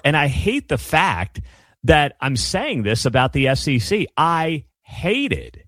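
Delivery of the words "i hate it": emphatic disgust. Real, not acted. Host's disgust at own words.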